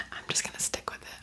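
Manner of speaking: ordinary talk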